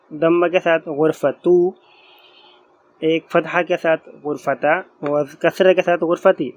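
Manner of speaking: गुरफतू, गुरफता, गुरफती dengan akhiran tu, ta, ti jelas; vowel endings. Shown as a demonstration. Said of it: "तो हम इसको इस तरह बोलेंगे".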